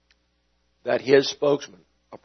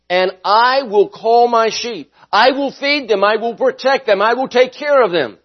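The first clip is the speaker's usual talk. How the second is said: higher, rising promise of Jesus; preaching; 8 semitones higher, 3 dB louder than usual. Acted. Pastor voicing Jesus the good shepherd, higher and lifting. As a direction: lift each "I will" higher.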